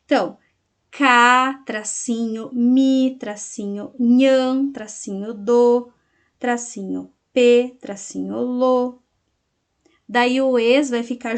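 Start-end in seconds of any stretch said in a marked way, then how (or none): 0.9-9.0 teacher dictating a word broken by dashes; syllable-by-syllable dictation. Shown as a demonstration. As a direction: spell it out slowly in separate syllables. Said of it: "Vocês vão separar as sílabas poéticas".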